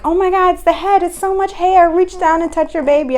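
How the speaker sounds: high, loud excited announcement; excited midwives. Retold or imitated. Midwives excited at baby's head.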